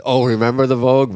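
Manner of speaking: excited recall. Retold or imitated. Quoting co-host's half-finished remark about a bar, excited recall. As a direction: pipe up with a sudden recollection.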